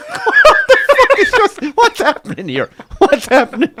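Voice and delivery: high, loud mock confusion; helplessly amused, incredulous quote; laughing bewilderment. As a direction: squeal it while cracking up. Retells bewilderment, cracking up laughing.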